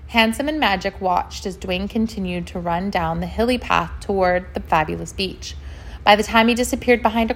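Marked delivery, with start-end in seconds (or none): none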